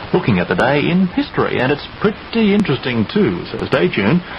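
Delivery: ordinary delivery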